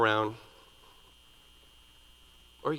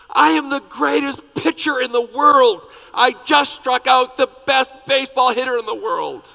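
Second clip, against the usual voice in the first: put-on triumphant little boy; 9 semitones higher, 9 dB louder than usual. Little boy crows that he is the greatest pitcher, loud. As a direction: crow about it, arms in the air. Boy brags after striking out.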